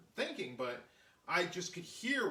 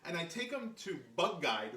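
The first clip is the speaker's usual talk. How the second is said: ordinary delivery, nothing marked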